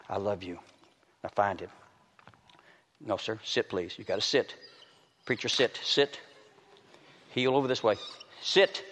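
low firm commands to the dog; soft steady dog handler; quiet dog commands. Real, not acted. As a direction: keep the dog in check softly.

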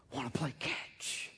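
hushed whisper, an intimate divine invitation. Retold or imitated. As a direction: breathe it gently as God calling his children. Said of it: "it's the father who comes to the wayward sons".

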